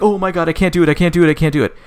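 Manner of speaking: high-pitched, repetitive fretting; acted panic. Girl reacts to a maggoty real hand, panicking.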